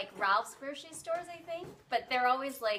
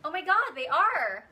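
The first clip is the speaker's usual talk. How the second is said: higher, louder sudden amazement; surprised; 5 semitones higher, 7 dB louder than usual. Real, not acted. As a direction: gasp at the taste. Tastes the seed, surprised.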